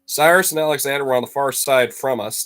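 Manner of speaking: ordinary delivery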